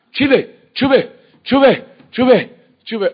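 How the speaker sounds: very high loud panicked yells; drowning man's shout. Retold or imitated. Drowning man crying to lifeguards, high shouting. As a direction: scream it out like you're going under. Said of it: "אז הוא צעק".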